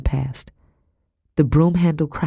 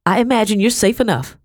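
folksy Becky, reassuring; 6 semitones higher, 4 dB louder than usual. A narrator's reading. Becky's dialogue in narrated story.

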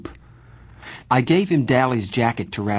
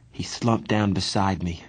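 plain reading voice

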